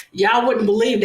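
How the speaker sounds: ordinary talk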